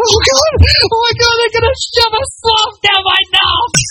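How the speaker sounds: high shrieking terrified yelp; panicked flatmate. Retold or imitated. Flatmate freaking out about a nose swab, shrieking high. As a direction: squeal in mock panic. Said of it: "I can hear it in his voice".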